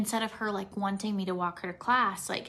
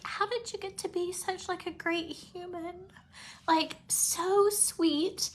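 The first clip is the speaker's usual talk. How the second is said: teary and moved; 8 semitones higher than usual. Real, not acted. Mom moved by daughter's kindness.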